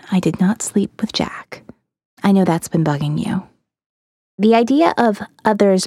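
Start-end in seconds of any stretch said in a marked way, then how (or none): 0.1-3.4 low confiding whisper; hushed, unvoiced admission. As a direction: breathe it like a secret between friends. Confessing secret to narrator.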